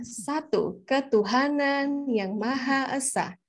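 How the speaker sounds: slow, measured "satu, ketuhanan yang Maha Esa"; ceremonial recital intonation. Shown as a demonstration. Reciter at school reading Pancasila, slow ceremonial intonation. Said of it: "intonasinya kayak".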